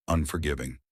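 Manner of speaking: ordinary delivery, nothing marked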